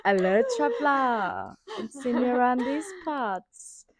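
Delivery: slow, drawn-out innkeeper greeting; imitating Trisha's slow tavern roleplay; mock tavern keeper. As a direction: play a hokey fantasy barmaid, slowly. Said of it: "she's like".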